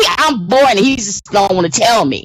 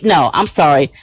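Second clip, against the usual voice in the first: ordinary delivery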